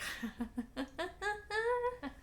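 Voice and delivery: high, hammed-up evil chuckle; mock villain laugh. Hades laughing at Percy's three pearls, hammed-up and high. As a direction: laugh like a gloating god of the dead. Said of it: "Hades is just like".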